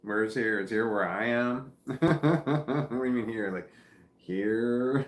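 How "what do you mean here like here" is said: laughing. Real, not acted. Confused by chat's "here.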